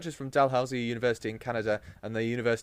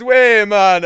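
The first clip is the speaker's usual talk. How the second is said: put-on loud, high Jamaican accent; 9 semitones higher, 17 dB louder than usual. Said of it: "do that Jamaican accent again".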